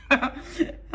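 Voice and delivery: silent, breathy wheezing laugh; breathless laugh. Real, not acted. Remembering the old lady's words, a breathy wheezing laugh. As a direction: let out an airless giggle.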